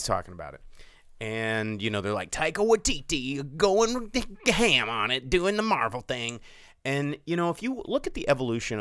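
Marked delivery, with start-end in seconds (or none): 2.3-6.4 put-on fanboy enthusiasm, stretched out; mock fan hype. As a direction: parody the hype around a director. Mocking hyped-up fans in a quote.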